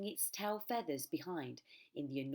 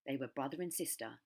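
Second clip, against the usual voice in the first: plain reading voice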